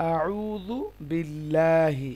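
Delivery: আউযু বিল্লাহি very slow, light L and long open "laa"; thin Lam pronunciation. Shown as a demonstration. Teacher shows the thin example, আউযু বিল্লাহি slow with light Lam. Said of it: "লামটা বারিক".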